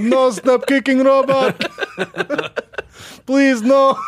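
high whiny robot begging for mercy; pleading robot voice. Pretend. Pleading robot being kicked.

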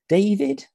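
"David" said DAY-vid, very high and rising; questioning pronunciation. Shown as a demonstration. Tries ways to say the name.

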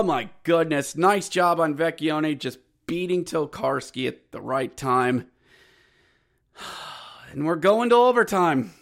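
usual speaking voice

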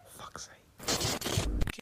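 sighing, whispered under breath; whispered exasperation. Real, not acted. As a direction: mutter it in a whisper. Frustrated sigh then whisper.

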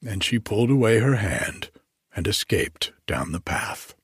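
ordinary delivery

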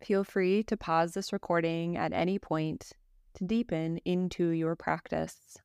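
calm, even yoga nidra guidance with long pauses; guided meditation. Acted. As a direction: guide a listener gently into rest, phrase by phrase.

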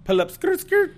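high squealing skrrt, shot up loud; hype ad-lib. Pretend. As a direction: yell it like a car peeling off. Hype call after a wild story.

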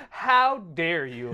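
slow, loud Southern indignation; Southern accent. Retold or imitated. Retorting to friends who doubt the accent, indignant Southern accent. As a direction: draw it out like an offended Southerner. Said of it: "doing a Southern accent".

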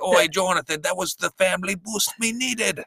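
high-pitched mock Australian-style accent; chorus it in a hammed-up Aussie-style accent; high Aussie-style imitation of a family; put-on Australian-style accent. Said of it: "I was done with accents".

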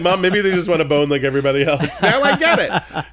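laughing through the words, loud and high. Real, not acted. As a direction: crack up while you say it. Laughing while answering mom.

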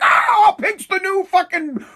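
high, squealed fake enthusiasm; mock gushing delight. Mimicking fans after Biden wears pink, mock delight. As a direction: gush like a swooning fan. Mocking fans' sudden delight.

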